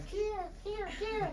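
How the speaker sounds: high, piercing kia kia bird cry; mimicked murrelet call. Teaching birders, imitating the murrelet's jet call. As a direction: imitate the bird's sharp call. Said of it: "they make this jet sound".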